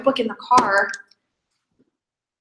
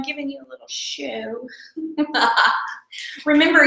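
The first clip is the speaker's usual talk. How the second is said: ordinary delivery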